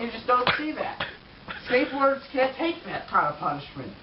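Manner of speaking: ordinary talk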